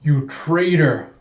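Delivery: drawn-out accusing shout; loud, slow retold denunciation; calling out. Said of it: "Alita calls out".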